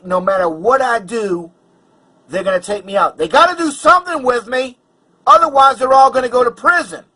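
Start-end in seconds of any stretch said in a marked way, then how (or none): 3.2-7.1 worked up. Real, not acted. Worked-up rant about the police.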